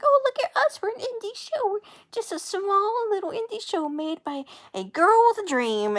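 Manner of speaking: high, loud, sneering mock brag. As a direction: parody their smug humble act. Mocking the show's self-image.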